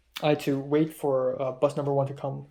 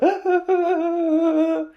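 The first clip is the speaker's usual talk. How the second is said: a big high burst of laughter, aped; imitating her loud laugh; 7 dB louder than usual. To the listener, aping how the girl laughed: high loud laugh. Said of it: "she's like that".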